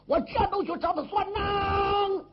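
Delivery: high, loud outraged cry; furious shout. Acted. Wounded brother vows revenge for his kidnapped nephew, furious high shout. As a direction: bellow it, ready to charge off for revenge.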